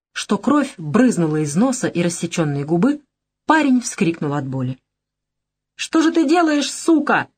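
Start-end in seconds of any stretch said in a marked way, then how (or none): 5.8-7.3 high, strained cry of pain; pained outcry. Acted. The hit young man crying out in pain, high. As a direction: yelp it out after the blow.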